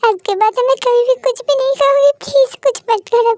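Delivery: high, urgent panicked pleas; frightened begging. Acted. Threatened girl pleads for her mother's safety, frightened begging. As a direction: beg desperately, pitch climbing as the promises repeat.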